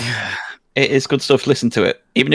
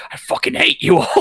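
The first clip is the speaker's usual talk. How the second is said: much higher, laughing mock rage; imitating frustrated Gadget. Mocking the game master's frustration, high laughing mock rant. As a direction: squeak out a fake fury at the whole group. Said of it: "and you're just like".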